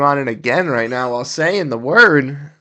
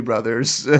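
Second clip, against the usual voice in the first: normal talking voice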